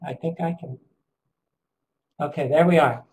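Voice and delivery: ordinary talk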